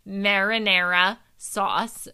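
loud, drawn-out Jersey "Marinara sauce"; playfully overdone Jersey accent. Shown as a demonstration. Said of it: "I say it very Jersey".

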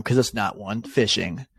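ordinary talk

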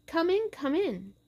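high, eager invitation; welcoming. A narrator's reading. Murderer greets the police officers at his door, welcoming. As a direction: usher them in with a high, lifted voice. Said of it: "I welcomed the officer".

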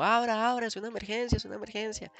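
high mock yell fading off; retold shout. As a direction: call out like strangers in a panicked bus. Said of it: "empezaron a gritar como". (grito imitado, agudo, que se apaga)

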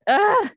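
a loud, high groan of anguish. Real, not acted. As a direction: groan it out, moved to tears. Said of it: "just started crying".

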